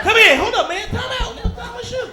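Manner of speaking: high, loud frantic plea for time out; hammed-up alarm, stalling desperately; mock panicked protest. Soldier caught tying his shoe begs captors, mock panic.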